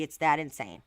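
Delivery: normal talking voice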